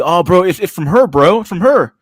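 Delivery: voz alta y emocionada, algo más aguda; excited disbelief. Retold or imitated. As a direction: dilo como quien no se lo cree. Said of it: "And you see".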